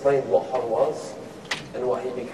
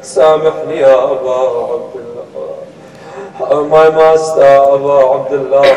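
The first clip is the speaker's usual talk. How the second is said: drawn-out, loud chanted wail; loud lamenting call with long-held syllables; retold scream; 11 dB louder, slower than usual. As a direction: cry out in a slow sung plea. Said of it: "And then he screams".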